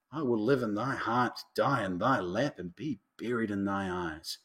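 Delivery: low, soft, intimate vow; tender vow. Acted. Lover vowing devotion to the beloved, tender and hushed. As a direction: pledge love quietly and low.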